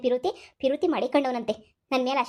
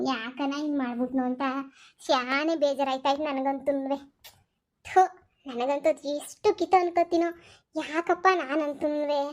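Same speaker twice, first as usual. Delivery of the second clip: sad lament; slower than usual. Acted. Parents lament their son. (ಮಗನ ಬಗ್ಗೆ ನಿಧಾನವಾಗಿ ದುಃಖದಿಂದ ಮರುಗುವುದು)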